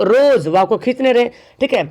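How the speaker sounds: ordinary talk, nothing marked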